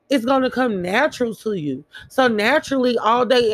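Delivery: normal talking voice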